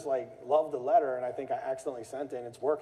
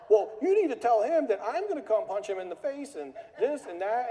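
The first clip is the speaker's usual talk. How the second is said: much higher, a bit louder threat; mock threatening caller; 9 semitones higher, 4 dB louder than usual. Caller threatening the boss, mock anger. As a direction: threaten like a furious caller, hammed-up.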